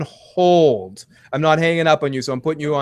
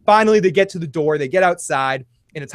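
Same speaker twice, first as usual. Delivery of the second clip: ordinary talk, nothing marked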